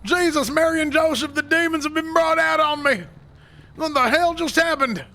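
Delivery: panicked exclaim. Acted. Sheriff reacts to the vanishing man, spooked high exclaim. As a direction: blurt it out, spooked by demon magic.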